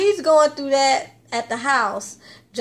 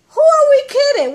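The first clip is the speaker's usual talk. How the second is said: high incredulous quip; 13 semitones higher, 6 dB louder, faster than usual. Real, not acted. Vlogger scoffing at the show's predictable plot, high and loud. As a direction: voice jumps way up, louder, scoffing.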